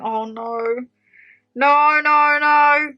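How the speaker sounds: long, held wail of dread; wailed dismay. Acted. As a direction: groan it out in mock despair. Acting out dismay at rooster crowing.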